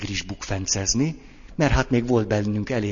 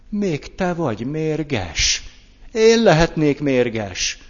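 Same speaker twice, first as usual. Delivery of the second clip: mock counterattack; 6 semitones higher, 5 dB louder, slower than usual. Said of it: "Vagy rögtön visszavágok".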